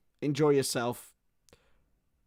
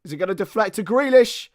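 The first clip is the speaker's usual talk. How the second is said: excited; 7 semitones higher, 9 dB louder, faster than usual. Real, not acted. Tense attack on goal.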